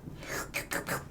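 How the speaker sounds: whispered gasp. Acted. Before the tuna.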